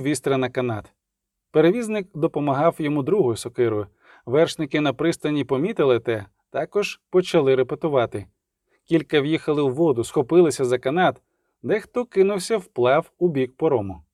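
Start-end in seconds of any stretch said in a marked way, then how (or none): none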